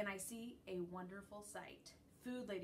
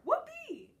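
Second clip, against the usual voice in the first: very high loud whoop of delight; joyful whoop; 10 dB louder than usual. A narrator's reading. Dog cheering a car ride, high whoop. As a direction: shout it like a thrilled dog.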